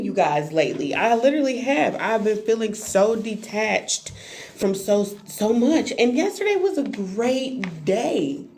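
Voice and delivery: normal talking voice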